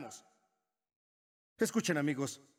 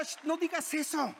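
Satan hushing; 7 semitones higher than usual. Acted. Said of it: "Pero Satanás dirá".

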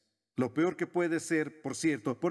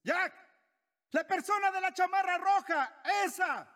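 much higher, called-out pointing voice; God shouting; 12 semitones higher than usual. Pretend. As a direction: shout it across the room like a wished-for command. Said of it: "Desearía que Dios gritara a veces".

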